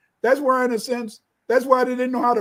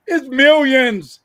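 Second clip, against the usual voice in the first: emphatic; 4 semitones higher, 8 dB louder, much slower than usual. Real, not acted. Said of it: "emphatic repetition".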